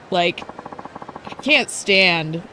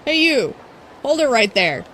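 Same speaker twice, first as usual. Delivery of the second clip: a hint of a haughty rival idol, higher on the call; bratty idol Ulala; 5 semitones higher than usual. Streamer voicing rival idol Ulala calling out Haruka, lightly haughty. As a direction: snap at the stranger like a spoiled idol.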